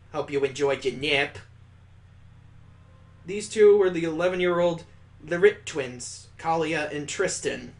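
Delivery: ordinary delivery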